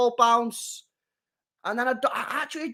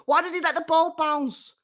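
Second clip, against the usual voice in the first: ordinary talk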